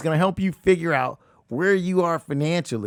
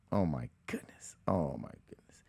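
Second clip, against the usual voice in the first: much lower and softer, defeated; exasperated sigh; 14 semitones lower, 14 dB quieter than usual. Real, not acted. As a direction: mutter it shaking your head. Exasperated at consumer debt.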